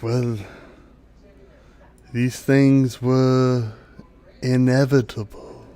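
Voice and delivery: very slow, measured dryad speech; solemn dryad. Acted. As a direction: break the truth gently with long pauses. Dryad gravely tells the child.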